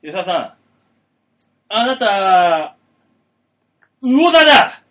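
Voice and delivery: unmask the culprit; dramatic detective reveal. Acted. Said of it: "探偵風なのかな".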